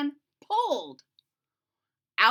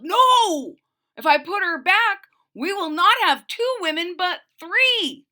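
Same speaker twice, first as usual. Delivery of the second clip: high, loud panicked husband yell; 8 semitones higher, 9 dB louder than usual. Acted. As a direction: shout her down in alarm. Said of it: "Mister Hawk Talk yelled".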